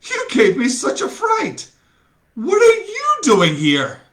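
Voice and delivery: put-on high, breathless-sounding girl's voice, still startled; flustered surprise. A narrator's reading. Helena catching her breath after Lysander startles her, high flustered voice. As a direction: speak as if the fright just passed.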